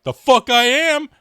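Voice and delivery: the New Zealand guy refusing to be called Australian; indignant yell. Retold or imitated. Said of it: "New Zealand guy was like".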